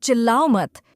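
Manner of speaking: sharp scolding. Acted. Sister tells little brother off, sharp scolding. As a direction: scold a noisy child firmly.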